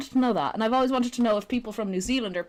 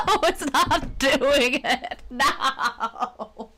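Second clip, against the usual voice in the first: loud, slow, laughing protest; real laughter breaking up the words; laughing dismay; 5 semitones higher, 4 dB louder, much slower than usual. Real, not acted. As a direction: wail it through helpless laughter. Desk trick fails, laughing.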